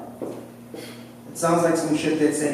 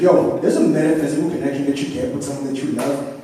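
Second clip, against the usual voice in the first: ordinary talk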